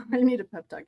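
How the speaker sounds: usual speaking voice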